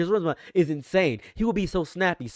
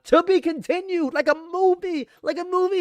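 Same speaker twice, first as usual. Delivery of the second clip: much higher, amused repeat; high mocking echo; 14 semitones higher than usual. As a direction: toss it back high, mocking. Commentator mocks, voice jumps high.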